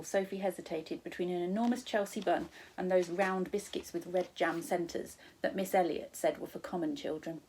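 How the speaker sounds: ordinary delivery, nothing marked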